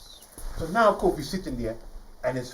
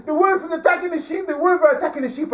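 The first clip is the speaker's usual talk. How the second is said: high, loud drawn-out cries of alarm; acted boy's urgent holler; yelling for help; 10 dB louder, slower than usual. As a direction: scream it down to the whole village. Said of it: "and yells down".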